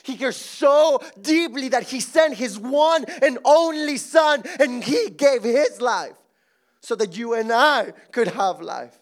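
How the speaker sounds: loud, rising pulpit cry; preaching shout. Acted. Pastor proclaiming to the church with a shout. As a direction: lift it to a shout on gave his life.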